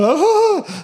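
very high, loud shriek of fright; very high laughing retold scream; terrified scream. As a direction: scream as he falls on you. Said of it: "they was like".

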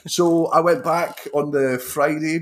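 normal talking voice

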